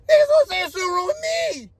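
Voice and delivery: very high, fast, strained yell; exasperated shout. Real, not acted. Man ranting about who is the problem, exasperated shout. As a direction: burst out in indignant frustration. Worked-up rant about being blamed.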